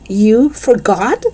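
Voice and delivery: slow, high disbelief; incredulous. A narrator's reading. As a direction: drag it out in disbelief. Dennis shocked, slow high.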